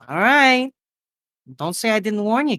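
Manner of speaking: high woman's voice giving in; high-pitched, singsong diner waitress; waitress warning. Acted. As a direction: warn lightly in a high waitress voice. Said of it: "She's like".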